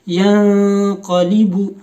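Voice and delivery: यंक़लिबु slow, न hidden in the nose; slow nasal Quran recitation; nasal ikhfa. Shown as a demonstration. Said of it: "नाक में आवाज़ छुपा कर".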